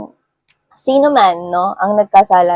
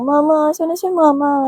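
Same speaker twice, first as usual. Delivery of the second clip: put-on scared child; 8 semitones higher than usual. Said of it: "takot kagaya ng bata".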